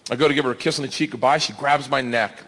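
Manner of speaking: ordinary delivery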